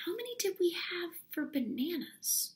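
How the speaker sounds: a hushed whisper of a question. Shown as a demonstration. Teacher whispering a question to the class. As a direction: lean in and whisper it.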